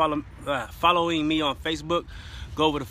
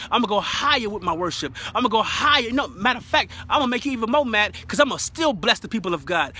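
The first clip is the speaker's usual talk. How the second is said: raised, forceful preacher's declaration; defiant preaching; 5 semitones higher, 3 dB louder than usual. Real, not acted. Preacher declaring to the devil that praise goes higher, defiant preaching. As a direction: declare it with full conviction, hammering each phrase.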